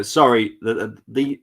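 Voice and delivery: usual speaking voice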